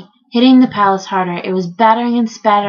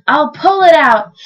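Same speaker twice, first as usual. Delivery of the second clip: louder, higher, drawn-out yell; 6 semitones higher, 5 dB louder than usual. A narrator's reading. Trumpeter trying to free his horn, yelled. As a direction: call it out with determination. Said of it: "he yelled".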